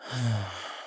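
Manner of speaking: long, soft falling sigh; dejected sigh. Acted. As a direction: sigh out softly in defeat. Despairing pause in monologue.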